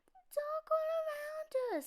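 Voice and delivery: high, small scared cub voice; a frightened little cub, voice way up high; little bear voice. Acted. Cub voicing fear of dark.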